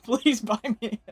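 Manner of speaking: laughing through the words. Real, not acted. Streamer joking with chat, laughing. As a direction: say it through a burst of giggles.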